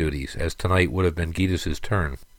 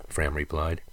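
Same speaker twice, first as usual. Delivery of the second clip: usual speaking voice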